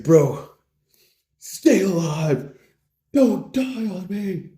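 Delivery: slow, high, desperate cry; melodramatic pleading. Acted. Bro begs his bleeding friend to live, melodramatically. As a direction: stretch each plea like an action hero.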